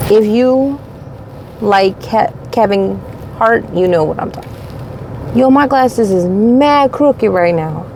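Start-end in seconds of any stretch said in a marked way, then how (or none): none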